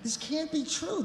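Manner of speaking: slow, stunned, rising to a high squeal; mock horrified kid; disbelieving child's inner voice; shocked disbelief. Kid's inner disbelief, acted.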